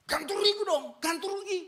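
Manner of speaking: a pushy driver demanding compensation. Retold or imitated. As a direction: driver demanding the preacher pay up. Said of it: "dia marah-marah".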